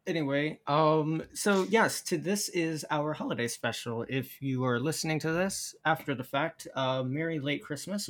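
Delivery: normal talking voice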